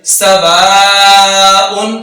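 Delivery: सवाउन chanted slowly with the waw held long. Shown as a demonstration. Teacher stretches the waw for students. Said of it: "वाव को थोड़ा लंबा करके पढ़ेंगे".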